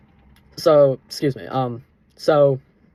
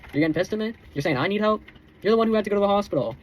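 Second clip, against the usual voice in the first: higher, fast indignant retort; 5 semitones higher than usual. Real, not acted. Speaking straight to his ex, indignant and raised. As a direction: call her out, rising.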